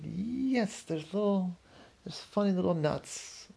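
a tiny creature chirping yes; lightly voiced butterfly. A narrator's reading. Butterfly answers about the tree.

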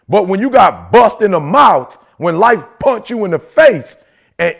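ordinary talk

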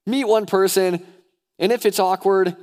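high, loud, insistent plea; emphatic urging. Real, not acted. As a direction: hammer it home to the congregation. Repeats the plea loudly, emphatically.

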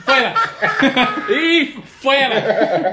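high, loud words through real laughter; laughing. Real, not acted. Joking with friends about the mother, laughing. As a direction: crack up while saying it. Cracking up at own joke.

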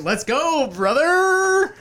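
high, loud rallying yell with a drawn-out last word; Adam Hughes impression. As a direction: holler it like an over-the-top hype man. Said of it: "do my best Adam Hughes impression".

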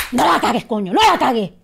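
loud, sharp shout at herself; self-scolding shout. Retold or imitated. Actress scolding herself mid-audition, loud shout. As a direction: yell at yourself not to blow it.

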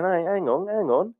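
rising, half-mocking "hang on"; mock protest. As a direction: act the public catching on. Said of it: "everyone's sitting back going".

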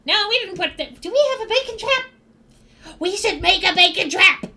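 high, rising goblin excitement; excited goblin. Acted. Background goblin excited about a bacon trap, high and eager. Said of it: "hear in the background".

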